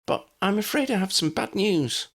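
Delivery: high, prim neighbour voice; a fretful older lady next door; high-pitched neighbour breaking bad news; fussy neighbour. A narrator's reading. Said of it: "his neighbour said".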